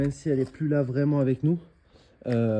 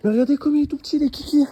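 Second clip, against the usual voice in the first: cooing; 11 semitones higher, 6 dB louder than usual. Real, not acted. Cooing over the little tree.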